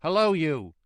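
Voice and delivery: loud, high challenging call; guard's hail. A narrator's reading. Guard hailing Jerry, loud high call. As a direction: shout a greeting as a challenge.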